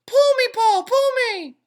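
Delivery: tiny, high, loud tempting voice; pigtail voice. A narrator's reading. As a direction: lure him like a little devil. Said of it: "a little voice coming from the pigtail".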